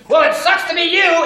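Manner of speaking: loud, high, hammed-up brush-off; mock rude shout. Imagined prospect rudely ending the call, mock shout. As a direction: yell it as an absurd rude reply. Acts a rude reply nobody gives.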